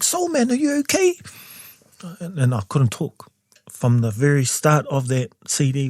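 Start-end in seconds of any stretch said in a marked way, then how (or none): 0.0-1.1 high, worried question; lightly mocked concern; concerned friends. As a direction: imitate friends checking on him. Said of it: "They're like".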